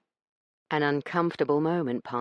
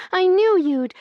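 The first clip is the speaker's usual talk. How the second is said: eager cry; 10 dB louder than usual. A narrator's reading. Io eagerly opens door.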